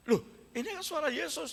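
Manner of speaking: sudden high, surprised gasp of recognition; startled recognition. Acted. Mary startled to hear Jesus's voice behind her, high surprised cry. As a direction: catch your breath as you know the voice. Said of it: "Kaget, Saudara".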